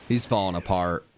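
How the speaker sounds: ordinary talk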